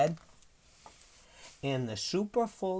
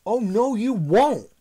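high, loud storybook shout; shouted defiance; 11 semitones higher, 12 dB louder than usual. A narrator's reading. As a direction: shout back defiantly as Hal. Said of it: "shouted Hal".

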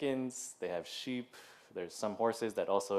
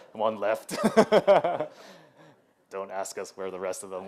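loud laughing imitation of a church friend, pitched up; Matt voice; 8 dB louder than usual. Pastor mimics Matt answering about chickens, loud laughing voice. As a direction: laugh it out as Matt would, big and bright. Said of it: "That's my Matt voice".

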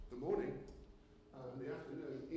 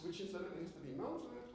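usual speaking voice

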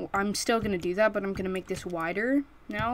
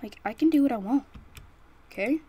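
sassy, fast and louder; defiant; 5 semitones higher, 4 dB louder, faster than usual. Real, not acted. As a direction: toss it back defiantly. Defiant retort to viewers.

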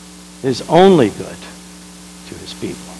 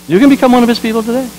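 higher, faster, pressing the invitation home; fervent; 9 semitones higher, 3 dB louder, faster than usual. Acted. Preacher to the congregation, quick and fervent. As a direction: urge it, quicker and up high.

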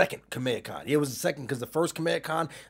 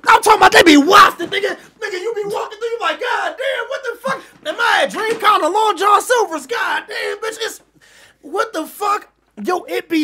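much higher, much louder, shrieking through laughter; laughing shout; 13 dB louder than usual. Real, not acted. Cracking up to his co-host about smelly convention crowds, shrieking laughter. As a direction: howl it out while cracking up.

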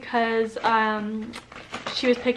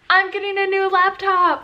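high-pitched excited announcement; 8 semitones higher than usual. Real, not acted. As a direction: burst out with the big news. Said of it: "I'm so excited".

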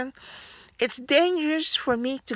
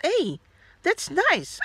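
pleased; 8 semitones higher than usual. Retold or imitated. Pleased self-talk in the shop.

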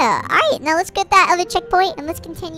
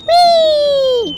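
high, drawn-out squeal of fun; playful whee; 10 semitones higher, 3 dB louder than usual. Pretend. Gamer flinging the fish over an edge, playful whee. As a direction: let it sail up high.

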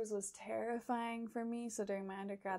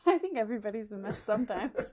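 laughing; 7 dB louder than usual. Real, not acted. Laughing reassurance after guest's joke.

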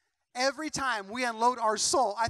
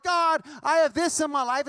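louder, higher call: "God, I have this in my life" cried out; crying out loud; 4 semitones higher, 7 dB louder than usual. Shown as a demonstration. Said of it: "and screaming out loud".

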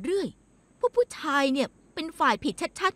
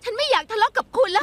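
sharp raised voice, louder and higher; upset outburst; 5 semitones higher, 5 dB louder than usual. Acted. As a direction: snap at husband, fed up. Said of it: "ไม่ต้องฉุนเฉียวแบบนี้". (ตะโกนอย่างหงุดหงิด)

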